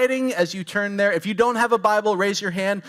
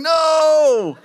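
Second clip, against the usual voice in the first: loud, high, drawn-out mock protest; hammed-up indignant yell, stretched long; old man's shout; 8 semitones higher, 7 dB louder than usual. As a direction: holler it like an old man from a balcony. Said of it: "old man now shouting from the balcony".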